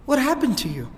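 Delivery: acted daughter, aghast and pleading; shocked daughter. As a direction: retell a girl's shocked dismay. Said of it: "and she gasps".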